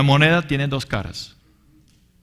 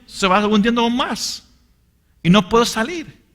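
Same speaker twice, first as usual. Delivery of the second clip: higher, pleading cry to God; retold desperate prayer; 7 semitones higher than usual. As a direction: beg the Lord, near tears, voice pitched up. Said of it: "y llorando, yo dije". (oración desesperada, con voz aguda y suplicante)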